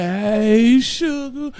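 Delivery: high, loud crooning hello; playful high-pitched greeting to a fan; pet-name greeting. Real, not acted. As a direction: call out sweetly, sliding up high.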